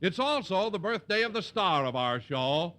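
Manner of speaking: announcer intro. Acted. Announcer presenting the cast and star to the audience, rising showbiz build. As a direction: build up to the star's name.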